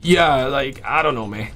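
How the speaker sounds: saving a tired voice; losing voice. Real, not acted. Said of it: "I'm losing my voice right now".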